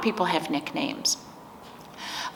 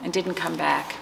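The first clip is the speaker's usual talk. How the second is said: put-on reading to children. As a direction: let each page breathe for the little ones. Reads picture book to children.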